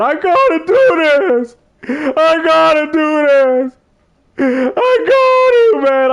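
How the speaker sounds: high, slow, teary whine; mock crying. Friend begging to go after his cheating girl, high slow mock sob. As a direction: drag the words out like a sobbing friend. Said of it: "the man started crying".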